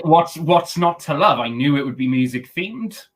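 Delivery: ordinary talk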